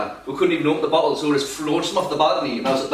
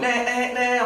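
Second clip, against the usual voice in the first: impatient driver; 9 semitones higher, slower than usual. Retold or imitated. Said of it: "a bloke behind me who was going".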